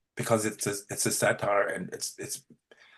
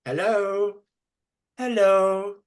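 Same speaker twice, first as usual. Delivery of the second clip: Hello? high and rising like a question; 9 semitones higher, 4 dB louder, much slower than usual. Shown as a demonstration. Teacher demonstrates a high questioning Hello?. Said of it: "Listen to the way I say it".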